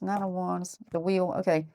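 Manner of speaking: ordinary talk, nothing marked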